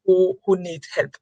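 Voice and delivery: usual speaking voice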